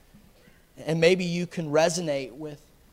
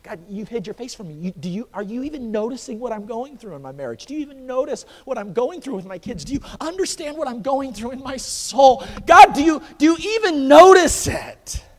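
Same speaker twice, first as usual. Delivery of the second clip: building plea; 8 semitones higher, 12 dB louder than usual. Retold or imitated. Believer pleading about marriage and kids, building to a cry. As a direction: swell from hurt to a shout.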